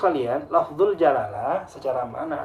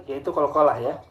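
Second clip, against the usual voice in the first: ordinary talk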